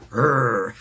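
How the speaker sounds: loud mock growl; playful monster snarl; werewolf growl.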